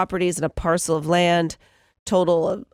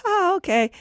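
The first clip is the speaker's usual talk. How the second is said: mock woman's voice; 10 semitones higher than usual. Woman brushing off the star.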